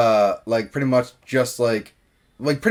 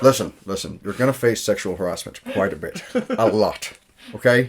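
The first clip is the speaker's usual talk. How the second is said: low Scottish-accented impression; lightly put-on Scottish accent, pitched down; Sean Connery; 6 semitones lower than usual. [name] warning her about what she'll face, in a low mock-Scottish accent. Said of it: "[name] is Sean Connery".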